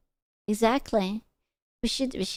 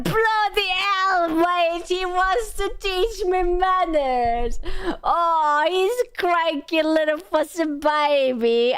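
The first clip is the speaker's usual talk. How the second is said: high, drawn-out mock outrage; mock outraged scolding; 6 semitones higher, slower than usual. Streamer mocking co-host who wants to teach manners, mock outrage. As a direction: stretch it out in exaggerated, whiny indignation.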